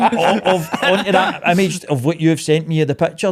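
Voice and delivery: loud, high-pitched sarcastic disbelief; mock incredulous. Shop owner answers photoshop accusation, loudly sarcastic.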